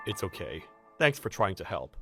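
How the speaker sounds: soft, low, forgiving; gentle reassurance. Acted. Newcomer thanking colleague for trying, gently reassuring. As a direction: let them off kindly, voice dropped.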